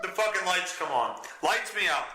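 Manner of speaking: ordinary delivery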